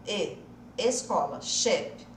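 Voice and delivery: E, Escola, Sheep all on one closed ê. Shown as a demonstration. Teacher links escola to the English word, closed ê. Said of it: "é o E de escola".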